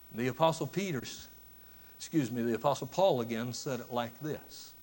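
usual speaking voice

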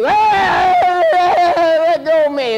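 put-on high, loud little-kid protest; toddler protesting. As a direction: squeal like a child pulling away. Child resisting being held.

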